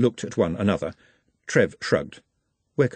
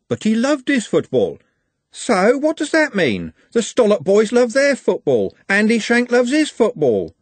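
raised, insistent and exasperated; heated argument; 11 semitones higher, 5 dB louder than usual. Acted. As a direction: argue it hard, louder each line. Heated argument over football.

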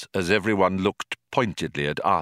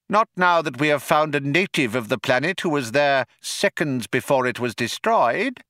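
high tiny mouse voice, self-satisfied; pleased little creature explaining; smug little mouse; 6 semitones higher than usual. Acted.

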